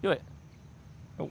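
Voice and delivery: ordinary talk, nothing marked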